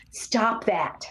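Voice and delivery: ordinary talk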